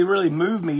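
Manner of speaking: normal talking voice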